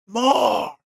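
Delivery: high drawn-out mock plea; squeaky-high imitation of a needy kid. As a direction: voice jumps way up, needy and exaggerated. Voices the child's need for more.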